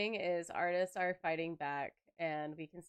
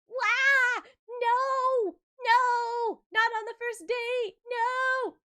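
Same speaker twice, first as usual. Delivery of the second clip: hammed-up high, loud shrieking; loud, squealing mock panic; panicked shriek; 10 dB louder, much slower than usual. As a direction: wail like a short-circuiting brain. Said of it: "my brain short-circuited and was like".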